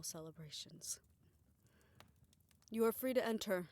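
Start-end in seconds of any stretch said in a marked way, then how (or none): 2.7-3.7 louder, call it toward the door. Acted. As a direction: raise the voice to someone outside. Calling through the door.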